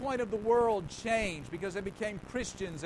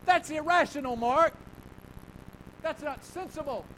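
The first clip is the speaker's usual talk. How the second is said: loud mock objection; 6 semitones higher, 7 dB louder than usual. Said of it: "imagined listener objecting".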